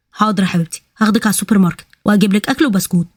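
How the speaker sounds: usual speaking voice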